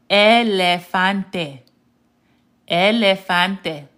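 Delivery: "Elefante" stretched very slowly, syllable by syllable; slow Spanish "Elefante", every vowel clear; sounding out. Shown as a demonstration. Teacher sounds out the word for elephant, slow deliberate "Elefante". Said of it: "let's sound this one out".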